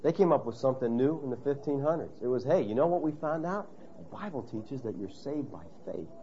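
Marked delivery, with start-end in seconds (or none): 2.4-6.1 hushed discovery. Acted. Reformers sharing their new discovery with the church, hushed. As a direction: softly confide a new finding.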